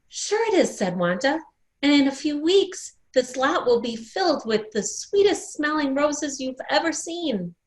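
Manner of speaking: put-on a child sure of her dream; eager insistence.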